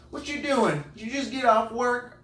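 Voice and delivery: high, loud imitated Southern voice; Southern accent. Neighbor quizzing him after work, high Southern accent. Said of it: "Real Southern accent".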